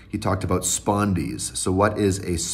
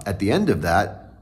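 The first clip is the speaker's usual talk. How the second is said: normal talking voice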